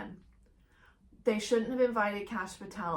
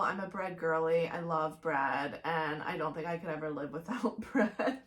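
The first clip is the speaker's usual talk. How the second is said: ordinary talk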